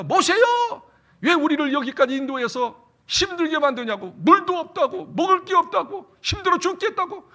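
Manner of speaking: high, loud grumbling crowd; whining complaint. Retold or imitated. Israelites grumbling at Moses, high loud whining.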